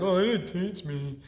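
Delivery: lightly mocking a whiny student; whiny excuse. Teacher mocking a lazy student's whiny excuse. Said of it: "Be like".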